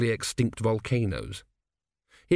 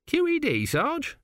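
much higher, baffled lad's voice; puzzled young recruit; slower than usual. Acted. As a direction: ask it like a lost green soldier. Narrator voices Autocue.

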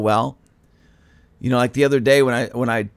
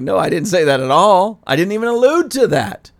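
higher, louder protest; exasperated denial; 6 semitones higher, 5 dB louder than usual. Retold or imitated. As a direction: push back hard, fed up. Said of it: "It's like".

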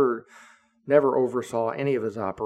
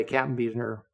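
normal talking voice

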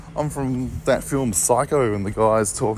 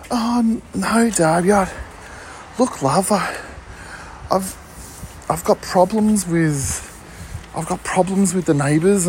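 higher, flustered mum listing her worries; mum's voice; 5 semitones higher than usual. Retold or imitated. Mum complains to her son about neighbours, higher flustered voice. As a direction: sound like a harried mum unloading her troubles. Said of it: "I can pretend that I'm having a phone conversation with her".

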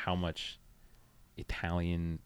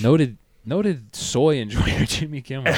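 mocking tease; 5 semitones higher, 7 dB louder, slower than usual. Mocking jab, slow and loud.

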